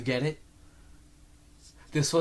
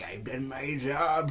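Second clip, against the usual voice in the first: slow, put-on character voice; drawn-out mock old-timer sign-off; satisfied cop baby; slower than usual. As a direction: say it slowly, like a job finished. Cop baby's closing line, acted.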